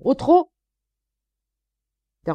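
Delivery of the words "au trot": high, loud shouted command; barked order. Acted. Sergeant ordering the prisoner out at a trot, shouted. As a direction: snap the command out.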